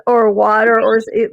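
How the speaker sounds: ordinary delivery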